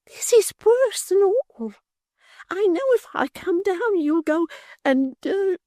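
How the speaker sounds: very high, slow, tearful fake fright; a martyr bidding farewell, squeaking; Miggs, faint and fearful. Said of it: "cried Miggs".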